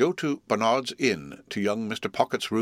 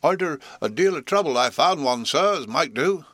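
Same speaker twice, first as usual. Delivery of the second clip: higher, louder cold-ridden voice; voice of a head cold; 7 semitones higher, 5 dB louder than usual. Acted. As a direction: talk like a man with a stuffed nose. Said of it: "in the voice of a sufferer from a constitutional cold".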